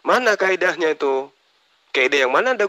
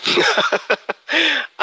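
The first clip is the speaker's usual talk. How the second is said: mocking high laugh; 13 semitones higher than usual. Real, not acted. Mocking the opponent's rule.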